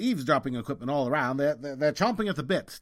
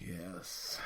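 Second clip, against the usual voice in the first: unvoiced, barely breathed yes; ashamed whisper; 6 semitones lower, 14 dB quieter than usual. Man admitting to his friend he turned her away, whispered. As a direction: confess it under your breath.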